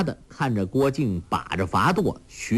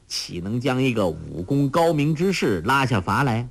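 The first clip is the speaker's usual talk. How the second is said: ordinary delivery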